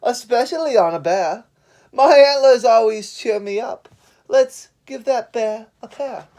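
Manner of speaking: loud, high moose voice boasting about antlers; complaining moose. Acted. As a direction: brag like a proud moose. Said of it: "Moose complained".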